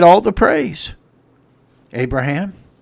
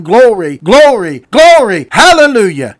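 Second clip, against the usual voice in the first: shouting glory; 3 semitones higher, 6 dB louder than usual. Acted. Preacher testifying to the congregation, shouting glory.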